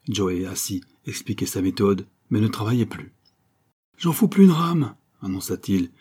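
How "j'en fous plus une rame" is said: loud, high declaration of revolt; defiant announcement. A narrator's reading. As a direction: announce a strike with bravado. Joey defiantly quits working. (refus provocateur, voix haute et forte)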